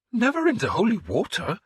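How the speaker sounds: scandalised protest; the priest scandalised by the confession; indignant. A narrator's reading.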